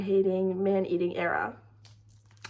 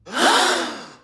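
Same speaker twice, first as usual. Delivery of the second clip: gasp; 5 semitones higher, 6 dB louder than usual. Retold or imitated. Hearing him gag.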